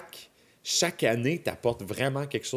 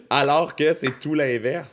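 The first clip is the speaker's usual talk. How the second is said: laughing; 4 semitones higher, 7 dB louder than usual. Real, not acted. Laughing while answering.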